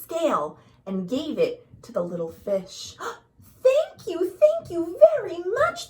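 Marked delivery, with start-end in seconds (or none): 3.0-5.9 much higher and louder, bubbling over; bubbly thanks. A narrator's reading. Little blue fish thanking the rainbow fish, bubbly and high. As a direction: gush with delight. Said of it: "bubbled playfully".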